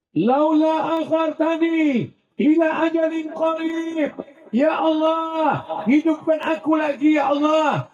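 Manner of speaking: desperate plea. Acted. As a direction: beg like a dead man begging to live. Dead man begging for life. (teriakan memohon dengan putus asa)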